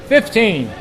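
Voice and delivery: ordinary talk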